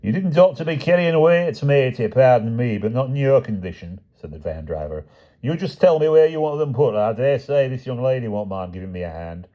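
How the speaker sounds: high, chatty Cockney delivery man; cheerful Cockney patter; a Cockney van driver reading his list. A narrator's reading.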